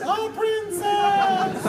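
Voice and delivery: sky-high, drawn-out mock preacher echo; very high-pitched voice. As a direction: stretch it out in silly falsetto. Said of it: "in a very high-pitched voice".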